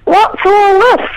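high, slow mock yell at the husband; retold yell. Yelling at the lazy husband on the bed, high and slow. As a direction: call it out in mock outrage, pitched high. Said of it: "I yelled out".

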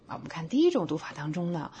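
ordinary talk, nothing marked

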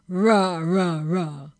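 loud bear roar; bear talk. Acted. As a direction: roar three times like a bear. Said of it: "It sounded like".

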